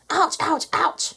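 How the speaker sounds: high, quick acted yelps; pained yelps. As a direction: yelp at each sharp peck. Cow being pecked by chickens.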